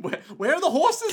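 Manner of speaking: high, laughing whine; mocking whiny voice. Voicing Carmilla, laughing.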